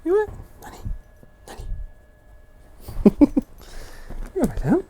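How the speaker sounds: ordinary talk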